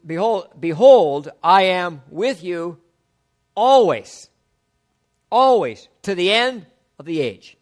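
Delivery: loud, slow, drawn-out declaration; fervent proclamation. Acted. To the congregation, proclaiming the promise, loud and slow. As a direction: ring out "always" like a promise shouted.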